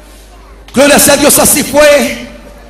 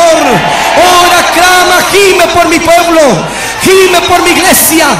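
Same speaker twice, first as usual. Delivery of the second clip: raised, pleading preacher's cry as God; imitated lament; 3 semitones higher, slower than usual. God begging Ricardo to pray, imitated lament. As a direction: plead urgently in an imitated anguished voice. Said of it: "una voz como lamento".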